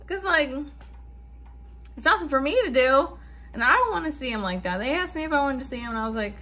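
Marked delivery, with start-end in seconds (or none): none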